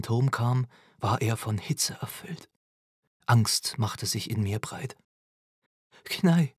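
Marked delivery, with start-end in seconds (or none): 6.0-6.5 put-on higher anxious call to a child. A narrator's reading. Mother hurrying up to her son in the tower, calling out. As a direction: call the name out, pitched up. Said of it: "rief ich".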